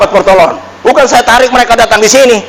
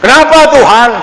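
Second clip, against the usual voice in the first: crying out; 5 semitones higher than usual. Retold or imitated. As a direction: raise the plea to a cry toward heaven. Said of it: "saya berseru sama Tuhan".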